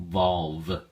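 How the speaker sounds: volve said alone and stressed; teacher isolating the stressed syllable volve. Shown as a demonstration. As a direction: hit the syllable volve clearly. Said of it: "the emphasis or stress is on the second syllable".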